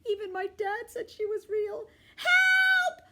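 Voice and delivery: high, loud terrified cry for help; panicked screaming. A narrator's reading. Kid panicking to dad about monster, high loud scream.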